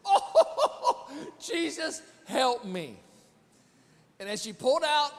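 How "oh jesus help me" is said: laughing plea. Shown as a demonstration. Pastor showing what he said when cut off. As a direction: laugh out a desperate little prayer. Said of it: "This is what I did".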